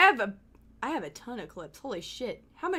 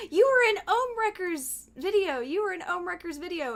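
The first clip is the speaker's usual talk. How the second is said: imitated excited viewers; 7 semitones higher, 4 dB louder than usual. Voices viewers repeatedly coming to tell her.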